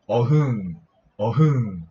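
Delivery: spoken-out lion roar, falling tone; lion roar imitation. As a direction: play a lion for guessers. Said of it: "This is a lion sound".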